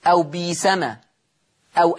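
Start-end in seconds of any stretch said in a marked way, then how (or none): 0.2-1.0 smooth long ee opening بيسمة; hamza turned to ya. Shown as a demonstration. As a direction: say بيسمة with a long ee, no glottal catch. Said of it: "تبدل ياء، فتصير بيس".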